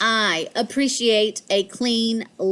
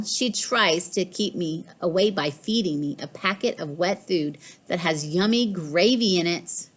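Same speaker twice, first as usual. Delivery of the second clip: ordinary delivery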